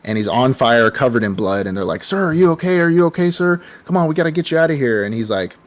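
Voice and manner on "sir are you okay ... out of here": high, fast worried calling; worried bystanders. Retold or imitated. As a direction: call out to him in alarm. Bystanders calling to burning man.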